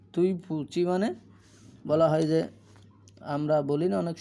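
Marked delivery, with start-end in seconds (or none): none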